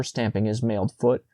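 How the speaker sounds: usual speaking voice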